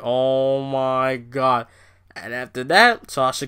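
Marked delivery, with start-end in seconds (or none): 0.0-1.7 very slow, drawn-out oh my god; dragged-out mock dismay; stunned disbelief. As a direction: let it sag out in dismay. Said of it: "I'm like".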